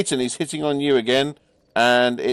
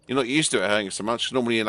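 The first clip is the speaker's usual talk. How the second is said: ordinary talk, nothing marked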